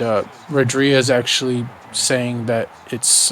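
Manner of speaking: ordinary delivery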